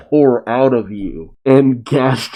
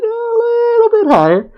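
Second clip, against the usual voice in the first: squeaky AI voice. Retold or imitated. Reviewer voices the aiming robot even higher. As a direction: push it way up, squeaking.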